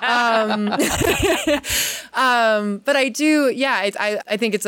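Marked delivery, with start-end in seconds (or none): 0.7-2.1 amused laugh. Real, not acted. Laughing at her own joke.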